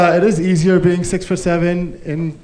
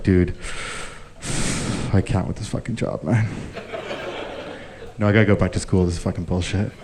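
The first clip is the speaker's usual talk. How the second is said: lower, softer, fed-up grumbling; weary horse voice; 7 semitones lower, 7 dB quieter than usual. Pretend. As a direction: grumble to a friend on a smoke break. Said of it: "smoking a cigarette with his friend".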